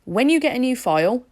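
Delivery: ordinary talk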